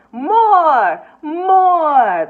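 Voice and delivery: loud eager shouts; shouting crowd. A narrator's reading. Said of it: "shoppers called out".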